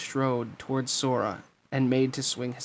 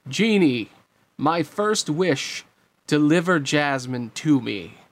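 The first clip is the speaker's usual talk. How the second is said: loud, slow, raised command; commanding villain; 3 semitones higher, 5 dB louder, slower than usual. Acted. Jafar orders the genie to bring Jasmine, commanding. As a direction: proclaim a wish with grand deliberation.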